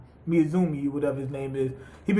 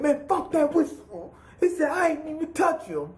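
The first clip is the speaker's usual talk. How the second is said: mock outrage; 8 semitones higher than usual. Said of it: "And Hyuga said".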